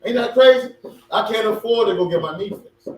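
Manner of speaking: ordinary talk, nothing marked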